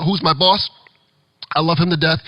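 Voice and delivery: usual speaking voice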